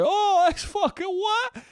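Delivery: very high, slow falsetto bafflement; high-pitched mock outrage; squeaky confused old guy. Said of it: "the Italians who are just like".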